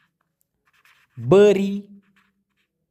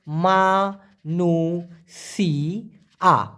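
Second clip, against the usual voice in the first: slow demo; much slower than usual. Shown as a demonstration. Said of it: "slow word demo".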